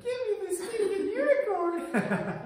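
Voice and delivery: high, slow mock scream through laughter; retelling the mom's outburst; laughing mock yell. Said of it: "And I was like".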